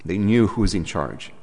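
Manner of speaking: ordinary talk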